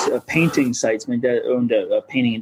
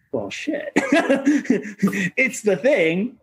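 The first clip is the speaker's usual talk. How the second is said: laughing; 7 semitones higher, slower than usual. Real, not acted. Laughing at the paradox.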